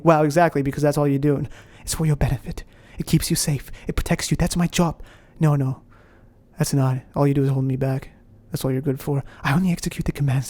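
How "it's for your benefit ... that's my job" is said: soft British voice; fake British accent. Inner critic defending his protective job, fake British accent. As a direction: justify yourself quietly in a put-on British accent. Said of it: "your fake British accent".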